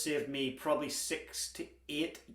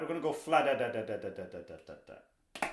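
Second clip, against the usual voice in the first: vocalized drum rhythm; 3 semitones lower than usual. Shown as a demonstration. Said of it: "just try that".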